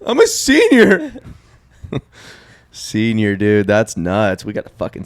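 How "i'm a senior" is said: slow, very high protest; drawn-out cracking mock falsetto; voice-crack imitation. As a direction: squeak it out like a breaking teen voice. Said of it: "Did your voice just crack?".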